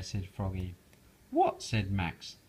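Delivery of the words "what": much higher startled; surprised what. Acted. Max startled by Froggy's idea, surprised. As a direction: yelp it in surprise.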